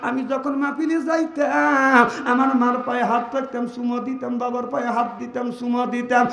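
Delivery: steady sermon voice at normal strength; measured preaching. Acted. Preacher recalling kissing his parents' feet, steady. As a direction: tell it calmly before the climax. First telling, moderate.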